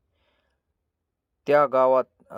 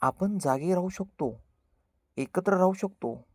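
higher, slow and soft, gently encouraging; smiling reply; 5 semitones higher, 4 dB quieter than usual. Acted. Shivaba answering his friends, smiling, soft and slow. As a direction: reassure the friends with a smile. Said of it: "Shivba हसतो आणि म्हणतो".

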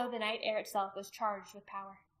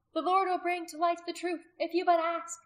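high, raised, fervent woman's voice; impassioned high-pitched testimony; earnest witness; 9 semitones higher, 7 dB louder than usual. A narrator's reading. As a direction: preach urgently to the crowd. Condemned woman preaching to crowd.

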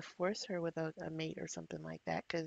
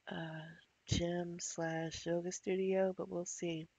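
usual speaking voice

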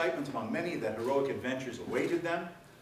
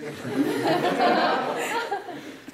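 loud, high burst of laughter; real laugh; 12 dB louder than usual. Real, not acted. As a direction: laugh hard at your own joke. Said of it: "teller laughs at own setup".

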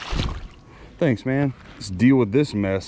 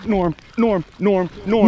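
calling a name higher and louder each time; excited calling out; 9 semitones higher, 4 dB louder than usual. Real, not acted. Calling his buddy over, fish on, rising and louder. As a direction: shout for your buddy, fish on the line.

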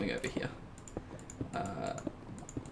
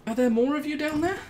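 fast high alarmed asking; high startled question; 12 semitones higher, 3 dB louder, faster than usual. Real, not acted.

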